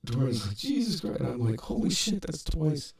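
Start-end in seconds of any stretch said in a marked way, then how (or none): 0.6-1.2 high mock-exasperated exclamation; retold shocked reaction. Retold reaction to second fall. 1.6-2.9 high, strained mock amazement; retold amazed reaction. Retold reaction to second fall.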